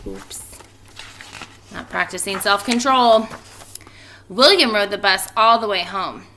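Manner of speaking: lively storybook reading voice; expressive read-aloud for a class; reading to children. A narrator's reading. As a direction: read it out like story time for kids. Teacher reads picture book aloud.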